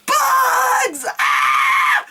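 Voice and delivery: high, loud panicked shriek; mock scream. The heroine sees the bugs, a loud mock shriek.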